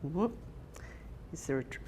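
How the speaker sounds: ordinary delivery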